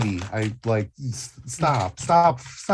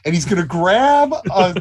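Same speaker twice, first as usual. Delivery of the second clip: usual speaking voice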